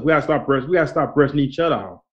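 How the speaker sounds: normal talking voice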